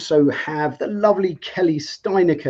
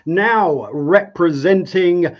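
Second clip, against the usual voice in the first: higher, drawn-out ringside announcer call; boxing ring announcer; 4 semitones higher, 3 dB louder, much slower than usual. Pretend. Host introducing the regulation side, like a ring announcer. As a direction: announce the fighter like a boxing MC, stretching the name. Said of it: "It's Jeff from the Red Corner".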